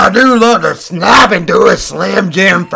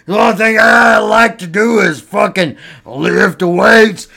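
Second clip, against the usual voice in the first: macho wrestler shout; 3 semitones higher than usual. Acted. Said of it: "It sounds like you need to masturbate".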